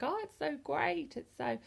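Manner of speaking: mocking the gushing fans, pitched high. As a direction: parody of breathless, over-the-top praise. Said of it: "everyone's like".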